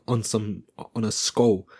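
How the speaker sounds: usual speaking voice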